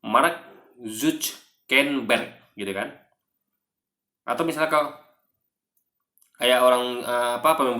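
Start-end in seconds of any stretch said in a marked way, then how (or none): 0.0-2.4 slow Mark Zuckerberg as marak zutskenberk; Indonesian way. Shown as a demonstration. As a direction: Mark Zuckerberg, syllables spelled out. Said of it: "dia akan melafalkan dengan".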